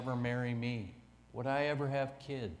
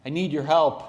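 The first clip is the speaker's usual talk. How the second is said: frantic plea; 3 semitones higher, 8 dB louder than usual. Retold or imitated. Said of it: "He called me into frantic".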